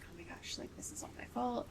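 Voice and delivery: breathy panicked whisper. Retold or imitated. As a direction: whisper it like a frantic thought. Panicked inner thought retold.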